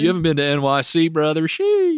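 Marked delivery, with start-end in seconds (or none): 1.5-2.0 high squeaky sheesh. Pretend. Catchphrase exclamation.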